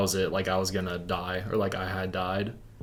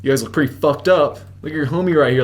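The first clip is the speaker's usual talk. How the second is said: louder, higher retold cop voice; confronting cop; 5 semitones higher, 7 dB louder than usual. Cop questioning a car full of friends, confronting. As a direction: imitate a cop pressing suspects.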